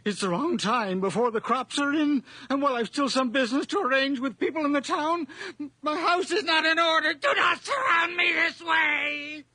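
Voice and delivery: rising to a high frantic shout; panicked pleading. Acted. Old man begging death to leave him, building to a terrified shout.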